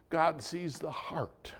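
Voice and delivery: slow hushed whisper; a soft, slow aside to the congregation. Real, not acted. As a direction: lean in and breathe it. Sudden whisper in sermon.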